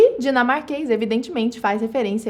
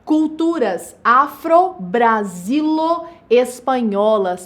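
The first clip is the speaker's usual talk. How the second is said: half-speed, deliberate compound; slow teacher's model phrase; slow, segmented enunciation; much slower than usual. Shown as a demonstration. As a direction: spell out each element for the class. Teacher models another compound adjective.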